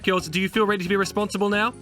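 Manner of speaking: ordinary delivery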